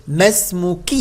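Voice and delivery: ما اسمكِ with kasra on the kaf, ending -ki; feminine kasra. Shown as a demonstration. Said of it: "كاف كسرة". (ما اسمكِ بكسر الكاف)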